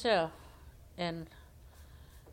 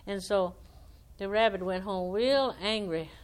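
ordinary delivery, nothing marked

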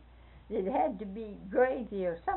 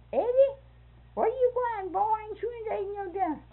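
much higher, fast, laughing-crying call; cook calling out; 12 semitones higher, 4 dB louder, faster than usual. Retold or imitated. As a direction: call after the running boy. Kitchen cook calling after boy.

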